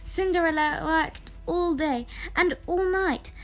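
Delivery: plain reading voice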